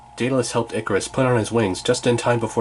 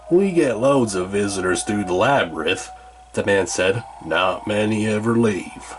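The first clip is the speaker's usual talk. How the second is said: put-on southern accent. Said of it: "southern accent".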